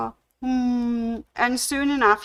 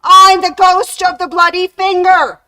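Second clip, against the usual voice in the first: very loud, much higher ghostly howl; booming ghost voice; 10 semitones higher, 12 dB louder than usual. Acted. Ghost standing in the doorway over the fainted man, booming. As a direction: announce yourself with a huge spooky howl. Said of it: "the ghost paused in the doorway and said".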